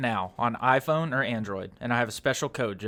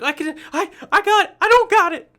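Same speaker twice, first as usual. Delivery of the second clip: much higher, louder, frantic imitation; panicky Peter Parker; 9 dB louder than usual. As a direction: squeak it out like a flustered teen hero. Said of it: "and he's like".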